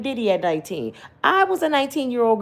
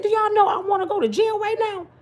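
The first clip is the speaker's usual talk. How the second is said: high, frantic hollering; hollering at police; 12 semitones higher than usual. Retold or imitated. Grandma hollering at police about maced grandbaby. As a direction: retell the yelling at the police, pitched way up. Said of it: "I said".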